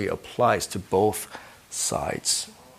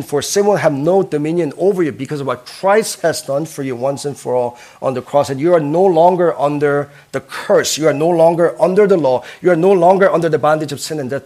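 loud, higher, building proclamation; emphatic preaching; 4 semitones higher, 8 dB louder than usual. Real, not acted. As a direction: drive it home louder and louder.

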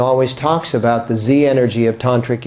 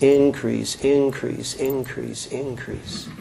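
each increase softer, trailing to near silence; fading repetition; 3 semitones lower, 6 dB quieter, slower than usual. Shown as a demonstration. Teacher to class, repeating increase as it fades out. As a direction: let the word fade away like an echo.

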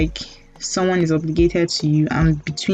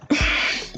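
breathy exhale; soft sigh; 4 dB quieter than usual. Real, not acted. After denial.

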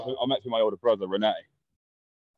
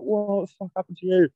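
high, put-on shocked mum; lightly voiced fussing mother; mock mum voice; 8 semitones higher than usual. Mother greeting her son, mock shocked mum.

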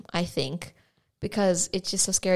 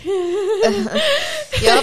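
much higher, laughing through words; 13 semitones higher, 5 dB louder than usual. Real, not acted. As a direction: giggle through the line. Laughs while talking.

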